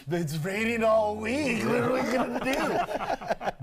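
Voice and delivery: slow, whining moan about the rain; mock whiny complainer. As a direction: play a Floridian sulking over a rainy week. Mocking Floridians complaining about rain.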